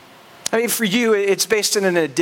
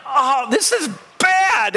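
dismayed; 5 semitones higher, slower than usual. Retold or imitated. Alone and freezing, realizes trouble.

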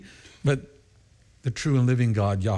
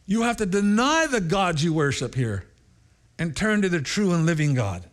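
high, urgent, laughing plea; urgent pleading; 10 semitones higher than usual. Retold or imitated. Pastor warning the eager Indian crowd, high and urgent. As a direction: hold the crowd back, urgent and much higher. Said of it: "I tell them".

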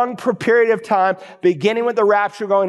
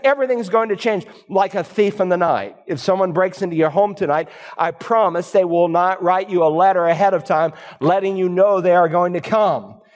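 normal talking voice

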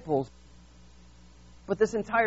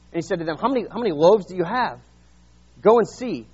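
louder, lifted Bible reading voice; scripture reading; 2 semitones higher, 5 dB louder than usual. Acted. Preacher reading the Gospel to his church, raised voice. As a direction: read the passage out with weight.

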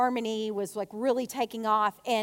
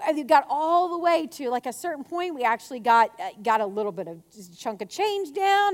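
ordinary talk

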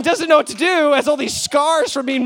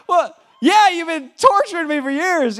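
higher sulky dog voice; voicing the dog; 6 semitones higher than usual. The dog retorting to its owner, a higher put-on voice. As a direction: answer back as the mistreated dog.

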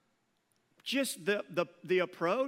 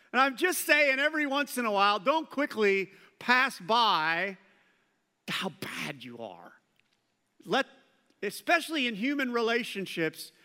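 usual speaking voice

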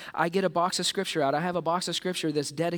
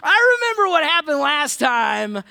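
loud, higher, hammed-up nervous backing out; reluctant disciple; 6 semitones higher, 7 dB louder than usual. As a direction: laugh it off and dodge the boat. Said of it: "And they're like".

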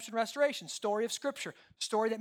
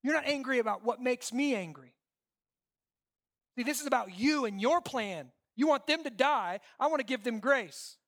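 raised, louder confronting voice; forceful rebuke; 6 semitones higher, 5 dB louder than usual. Acted. God rebukes Jonah about his plant, forceful. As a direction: press the contrast hard, pitched up.

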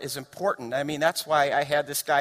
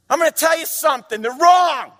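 much higher, shouted preaching climax; full-force preaching; 11 dB louder than usual. Acted. Pastor tells college students their professors are wrong, shouting. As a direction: thunder it at the congregation like a preacher.

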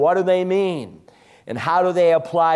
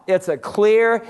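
ordinary delivery

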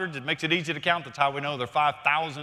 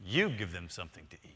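Jesus's command, much lower and softer; preacher quoting Jesus low and soft; hushed; 11 semitones lower, 10 dB quieter, faster than usual. Retold or imitated. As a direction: lay the words down gently, almost under the breath.

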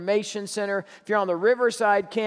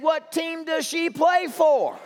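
much higher, slow, half-laughing shout; blurted punchline; 8 semitones higher, slower than usual. Acted. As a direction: kid blurting a rude question to the manager, high laughing shout. Punchline, kid blurts reply.

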